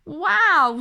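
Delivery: high, loud delighted gasp of wow; be wowed by the climb; amazed wow. Acted. Bubbles amazed at how fast Desmond climbed, high and loud.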